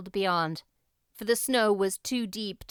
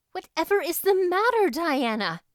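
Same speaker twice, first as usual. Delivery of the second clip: high, urgent call; alarmed cry; 9 semitones higher, 4 dB louder than usual. A narrator's reading. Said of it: "cried Anne".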